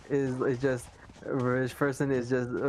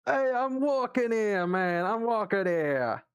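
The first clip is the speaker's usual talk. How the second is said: a touch of New York, slow and a bit higher; New Yorker accent; 7 semitones higher, slower than usual. Retold or imitated. Trying a New York voice for friends. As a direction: lean into a cab-dodging New Yorker, lightly. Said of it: "a New Yorker kind of voice".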